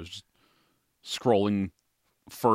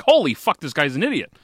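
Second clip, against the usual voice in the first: exclaiming; 5 semitones higher, 7 dB louder than usual. Retold or imitated. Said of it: "I'm like".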